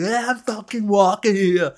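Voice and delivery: high, loud mock New York yell; rude New Yorker. Stranger yelling at a toddler in a stroller, mock rude New Yorker. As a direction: snap it like a rude passerby.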